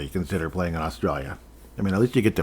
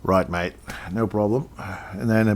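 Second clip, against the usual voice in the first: normal talking voice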